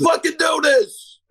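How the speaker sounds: high, thrilled cry of disbelief; excited outburst. Real, not acted. Hearing he's going into the Hall of Fame, excited cry. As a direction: burst out, overjoyed.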